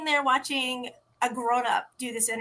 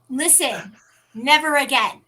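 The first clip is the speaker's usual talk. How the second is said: mock scolding; 2 semitones higher, 6 dB louder than usual. Scolding the kid playfully.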